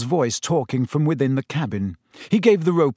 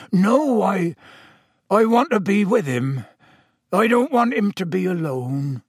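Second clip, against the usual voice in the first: higher, upset protest; distressed pleading; 7 semitones higher than usual. Acted. Owner begs to stay with his doomed animal, distressed. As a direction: plead with feeling, voice lifted.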